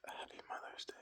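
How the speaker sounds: breathy whispered aside; a teasing whispered greeting to viewers. Real, not acted. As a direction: whisper it like a secret. Whispered joke, then "just kidding.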